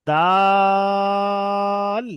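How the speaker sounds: দাল held very long, slow; দাল drawn out for the class; long drawn dal. Shown as a demonstration. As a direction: stretch দাল out slowly. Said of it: "তারপরে আছে দেখেন".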